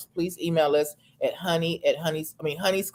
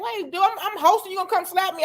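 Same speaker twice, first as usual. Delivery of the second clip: high-pitched mock protest; 12 semitones higher than usual. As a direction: squeak it out like a startled host. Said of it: "I feel he should have just been like".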